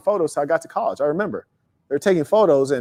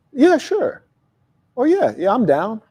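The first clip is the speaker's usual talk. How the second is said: casual acceptance; 7 semitones higher, slower than usual. Acted. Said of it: "Major difference".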